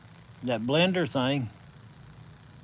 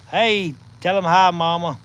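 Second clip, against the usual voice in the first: calling out; 5 semitones higher, 6 dB louder than usual. Real, not acted. Calling to Mama across room.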